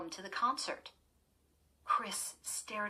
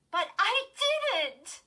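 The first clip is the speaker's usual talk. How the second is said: high, loud, slow shriek of denial; 9 dB louder, much slower than usual. Acted. Girl shrieking her denial at her sister, high and loud. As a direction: shriek it, desperate not to be blamed. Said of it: "Chris shrieked".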